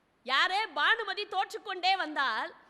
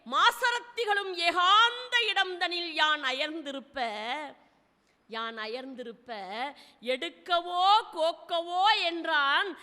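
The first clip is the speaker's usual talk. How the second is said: slow, sonorous verse recital; solemn classical Tamil poem, drawn out; poem recitation; slower than usual. Acted. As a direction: chant the old verse slowly with weight.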